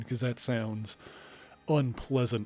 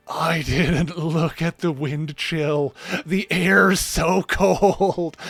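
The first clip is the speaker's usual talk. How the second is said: slow loud laughing retold moan; laughing complaint; 4 semitones higher, 7 dB louder, much slower than usual. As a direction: moan about the cold, laughing. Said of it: "I was like".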